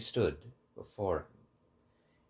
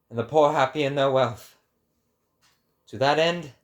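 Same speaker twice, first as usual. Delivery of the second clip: raised, louder, grand prophetic address; proclaiming to the sun; 8 semitones higher, 8 dB louder than usual. Acted. Zarathustra hails the rising sun with a louder, higher, grand declamation. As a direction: declaim it like a prophet hailing the sunrise.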